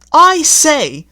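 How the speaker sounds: very high, loud prim coach voice; a fussy old coach complaining; haughty coach. A narrator's reading. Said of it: "said the lean coach".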